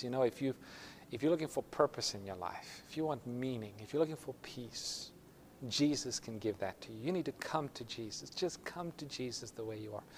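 soft, tender invitation; hushed altar appeal. Retold or imitated. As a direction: imitate a preacher gently calling people forward. Preacher's altar appeal, retold.

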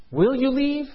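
high, rising, insistent question; impassioned plea. Acted. Preacher asks listeners as the parents, impassioned. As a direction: press the question harder, pitch climbing. Second, escalating pleading question.

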